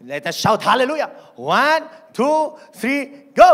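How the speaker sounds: loud, high call to shout; a pastor whipping up the crowd loudly; rallying call. Real, not acted. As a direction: fire up the church. Rallying the congregation.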